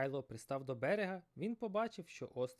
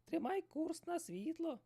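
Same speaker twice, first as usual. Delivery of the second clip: shouted; slower than usual. Acted. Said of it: "закричала Ай-Ай".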